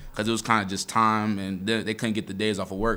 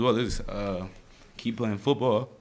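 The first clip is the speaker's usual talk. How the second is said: crying softly; 5 dB quieter than usual. Real, not acted. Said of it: "Linebackers cry, too".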